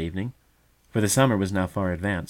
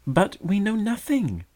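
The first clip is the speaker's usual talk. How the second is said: much higher, sharp retort; doubting brother bird; 12 semitones higher than usual. A narrator's reading. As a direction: fire back like a sceptical youngster.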